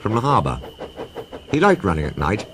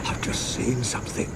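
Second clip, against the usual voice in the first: hushed, breathless whisper of fright; shaky frightened whisper; 5 semitones higher, 9 dB quieter than usual. Acted. Toby whispering to Thomas about the ghost, scared. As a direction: whisper as if the ghost might hear. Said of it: "said Toby in a shaky voice".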